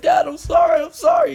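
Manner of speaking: retold sobbing plea, very high and loud; crying apology. Five-year-old begging his angry dad, crying out sorry. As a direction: wail the apology like a scared little kid.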